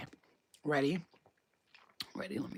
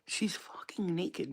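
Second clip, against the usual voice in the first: ordinary delivery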